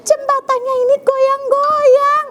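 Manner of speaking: a small bunny scared of the swaying bridge; little rabbit crying out to his mother; frightened little Kiko. Acted.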